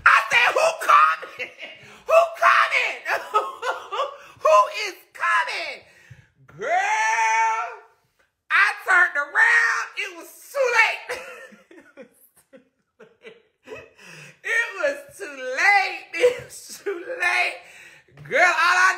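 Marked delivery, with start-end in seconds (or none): 0.0-5.8 much higher shrieking laugh-cry of who coming; mock panicked yelp. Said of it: "All I heard was this". 10.0-17.5 high and slowed, cracking into tears of laughter; laugh-crying. Real, not acted. Laughing helplessly at the memory.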